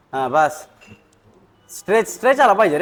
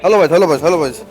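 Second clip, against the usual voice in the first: loud hello to the gym crowd; loud cheerful greeting; calling out; 8 dB louder, faster than usual. Real, not acted. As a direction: call out to the guys across the room.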